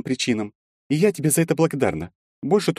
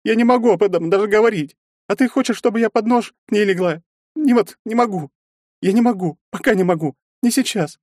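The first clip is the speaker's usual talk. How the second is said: high, louder, weeping plea; tearful pleading; 4 dB louder than usual. A narrator's reading. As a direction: beg him to leave through tears. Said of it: "и расплакалась".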